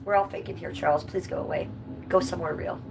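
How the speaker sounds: ordinary delivery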